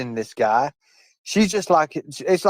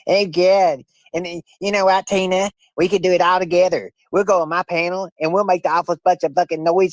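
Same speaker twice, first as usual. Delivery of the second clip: higher, mocking put-on voice of Mama T, jeering; Mama T impression; 4 semitones higher than usual. Streamer mocks Mama T to Tina, higher jeering put-on voice. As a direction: sneer it out in a raised mock-Mama voice, taunting. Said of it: "I finally got her fucking voice down".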